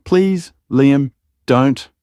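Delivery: high, desperate plea; begging. Acted. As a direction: beg him to stop, voice cracking upward. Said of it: "I begged".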